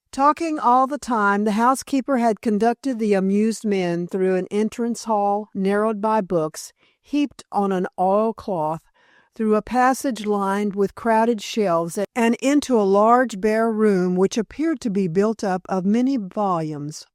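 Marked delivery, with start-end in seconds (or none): none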